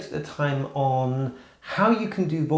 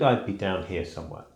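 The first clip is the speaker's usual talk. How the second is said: much lower, softer male register; guy mode voice; 8 semitones lower, 3 dB quieter than usual. Shown as a demonstration. To viewers, showing her everyday guy-mode range, much lower. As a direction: drop down to the bottom of the voice. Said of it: "if I were talking normally in guy mode".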